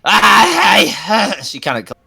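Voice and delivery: ordinary talk, nothing marked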